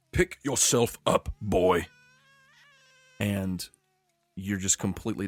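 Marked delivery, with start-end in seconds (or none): 0.1-1.9 mild mock drill command; commander yelling. GM voicing the commander in the flashback, mild yell. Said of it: "commander yelling out".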